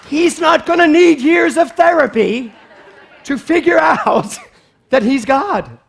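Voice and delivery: loud, high, laughing through the words; preacher laughing at her own story. Real, not acted. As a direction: laugh it out in delight.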